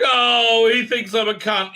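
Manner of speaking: high, loud offended gasp; a hammed-up indignant whine; mock wounded huff. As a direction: play her affront for laughs. She reacts to his exit.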